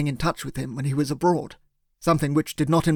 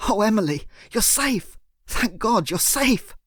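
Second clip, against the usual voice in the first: much higher, slowing, choked-up cry of relief; tearful relief; 6 semitones higher than usual. Acted. Narrator hugging Emily, crying with relief she is safe. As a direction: sob out the relief as you hold her. Reunion, tears flowing down face.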